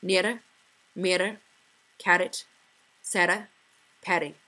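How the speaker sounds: nera, carrot, Sarah with a rolled R; Scottish accent, tapped R; trilled Scottish R. Shown as a demonstration. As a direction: roll every R the Scottish way. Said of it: "For example".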